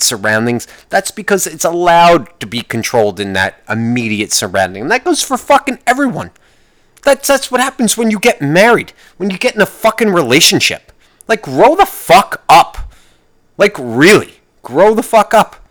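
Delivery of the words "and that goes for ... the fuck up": angry rant. Real, not acted. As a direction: tell them to grow up, fed up. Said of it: "Did I just get angry too much?".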